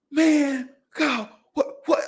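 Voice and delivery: stunned disbelief. Retold or imitated. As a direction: yelp it like a stunned brother. Brother stunned by dad shooting.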